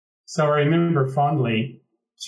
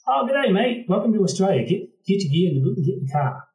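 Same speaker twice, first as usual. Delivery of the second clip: high, broad Australian taxi driver voice, much higher; mimicked Australian accent; 8 semitones higher than usual. Sydney taxi driver greeting a new arrival, in a high Australian accent. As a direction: play the cheery Aussie cabbie in an Australian accent. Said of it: "I'll go and mimic the accent".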